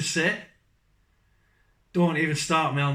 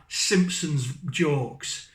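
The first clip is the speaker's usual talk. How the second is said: ordinary delivery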